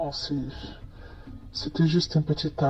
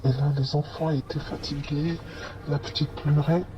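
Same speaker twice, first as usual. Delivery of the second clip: ordinary delivery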